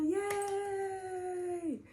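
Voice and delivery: high, bright-eyed cheer; sudden high cheer; yay. Real, not acted. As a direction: squeal it like a little celebration. Cheering her own welcome.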